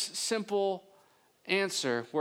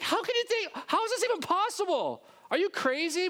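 are-you-crazy incredulity; 13 semitones higher than usual. Shown as a demonstration. Mary to the angel, high and incredulous, are you crazy. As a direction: sound like she thinks he's lost his mind. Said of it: "that tone of voice is".

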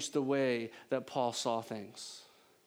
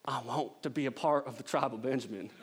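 put-on Southern accent; 3 semitones lower than usual. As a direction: say it in a hammed-up Southern accent, amused. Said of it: "read this in a more southern accent".

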